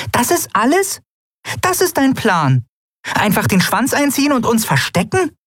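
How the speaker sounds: very high, outraged little character; high-pitched, scandalised questioning; indignant challenge. A narrator's reading. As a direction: throw the plan back in disbelief. Nelen challenges the plan, hands on hips.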